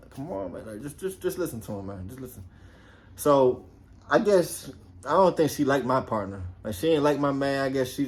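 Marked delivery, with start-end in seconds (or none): 0.1-2.4 much softer, half under the breath; quiet aside. Retold or imitated. Officer quietly urging Love to just listen, soft aside. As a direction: mutter it so the partner can't hear.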